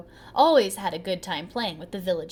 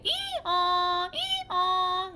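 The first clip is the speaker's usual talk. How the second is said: higher braying ee-aw; donkey bray; 8 semitones higher than usual. A narrator's reading. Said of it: "The donkey brayed".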